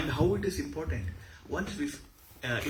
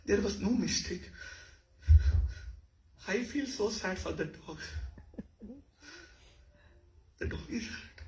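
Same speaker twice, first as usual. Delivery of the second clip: high, tearful acted sorrow with long pauses; grieving empathy; 10 semitones higher, 3 dB louder than usual. Shown as a demonstration. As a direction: grieve for a hurt animal, voice pitched high and breaking into breaths. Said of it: "empathy. She was speaking about the dog".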